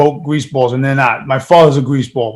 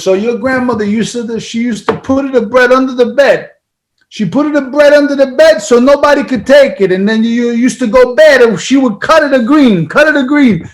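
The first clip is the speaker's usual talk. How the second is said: put-on heavy Italian accent, raised pitch and volume; Italian dad accent; 8 semitones higher than usual. Son voicing his Italian dad's war story in accent. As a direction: impersonate the immigrant father telling a wartime story. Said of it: "you nailed the accent too".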